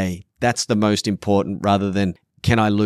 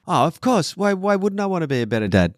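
high, sing-song mock excuse; mocking dismissive quote; 9 semitones higher than usual. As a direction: brush it off like a lazy cliché answer. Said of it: "That's not a fucking answer".

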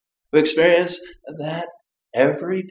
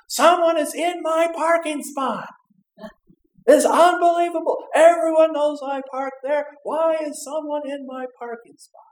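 indignant complaining; 12 semitones higher, 5 dB louder than usual. Acted. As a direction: fume about the stolen parking spot. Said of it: "you said".

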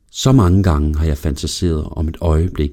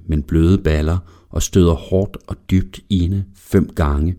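ordinary delivery, nothing marked

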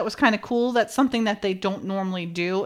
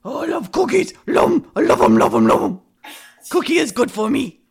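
high, gobbling cookie-crazy monster voice; greedy puppet monster raving about cookies; 5 semitones higher, 5 dB louder than usual. Retold or imitated. Host gifting co-hosts a soundbite, high Cookie Monster voice. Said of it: "a free soundbite of Cookie Monster".

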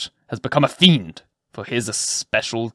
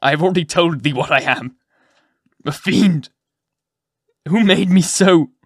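raised, forceful outburst; fierce accusation; 5 semitones higher, 6 dB louder than usual. A narrator's reading. Physician confronts Hester, evil showing.

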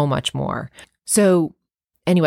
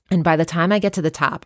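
usual speaking voice

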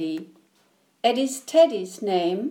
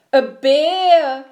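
loud, high cry of alarm; storybook scream; 6 semitones higher, 10 dB louder than usual. A narrator's reading. Eddie coming face to face with a bear, screaming. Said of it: "screamed Eddie".

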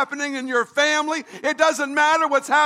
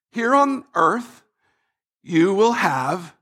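slow, halting reading that breaks off; reluctant reading; 5 semitones lower, slower than usual. Acted. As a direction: read it reluctantly, stalling before the unwelcome word. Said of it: "the phrase we do not like".

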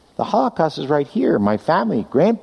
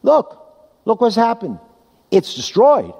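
mock protest; 5 semitones higher than usual. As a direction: pitch jumps high on look, pleading. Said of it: "I would have been up here saying".

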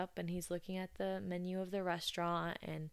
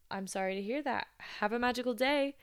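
chipper customer service voice; 5 semitones higher, 5 dB louder than usual. Retold or imitated. Cast member's chipper retort.

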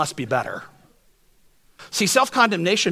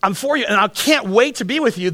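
fervent devotion; 6 semitones higher, 3 dB louder than usual. Retold or imitated.

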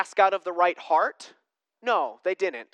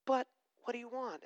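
soft question; 4 semitones higher, 10 dB quieter, faster than usual. Retold or imitated. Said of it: "Jesus asking".

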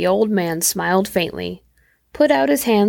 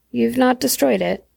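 usual speaking voice

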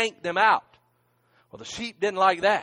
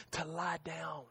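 hushed whisper; 3 semitones lower, 18 dB quieter than usual. Acted. Preacher whispering the psalm to the congregation, hushed. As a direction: drop to a breathy whisper.

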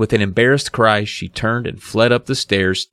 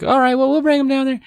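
high, quick put-on voice; mock girlish okay; imitated girls agreeing; faster than usual. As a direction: mimic girls giving in, fast and high. Voicing the girls giving in.

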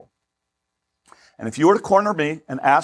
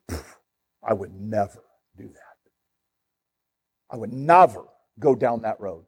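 low, self-righteous refusal; Pharisee disdain; 5 semitones lower than usual. Acted. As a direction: recoil in pious disgust, pitched down. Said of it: "That was their attitude".

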